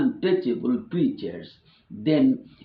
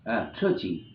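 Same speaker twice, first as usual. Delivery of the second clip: usual speaking voice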